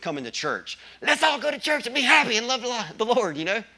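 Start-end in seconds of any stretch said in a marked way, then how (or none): 1.0-3.4 high, loud sing-song parody of a yelling parent; sarcastic churchgoing parent, loud and high; mock cheery yell. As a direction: ham up the forced-happy parent snapping at the kids. Mocking parent yelling at kids.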